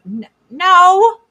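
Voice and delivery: usual speaking voice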